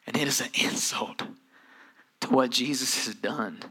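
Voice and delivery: hushed, breathy whisper of conviction. Real, not acted. Preacher whispering to the congregation about insult. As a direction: drop it to a whisper.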